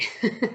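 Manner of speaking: a quick bright burst of laughter; short laugh. Real, not acted. Laughing while telling about the big guy, short laugh. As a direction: laugh it off lightly.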